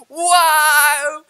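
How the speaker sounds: loud, high, drawn-out wow; amazed wow. Retold or imitated. Retelling seeing three big centurion guards, loud amazement. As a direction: belt it out in wonder. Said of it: "and I'm like".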